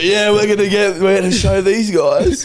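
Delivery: high, slowed mock boasting; playful mock threat; mock bravado. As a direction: ham up tough-guy taunts. Retold taunting the family.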